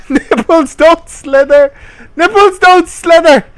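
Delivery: high, loud indignant yell; laughing outraged shout. Real, not acted. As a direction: shout the objection through laughter. Outraged at the prose.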